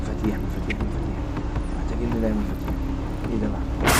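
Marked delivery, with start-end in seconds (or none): none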